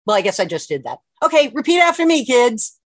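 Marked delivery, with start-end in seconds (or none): 1.2-2.8 put-on high, loud, fast call to the kids; rally the kids to repeat; excited call to kids. Shown as a demonstration. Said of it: "ask everybody to repeat immediately after me".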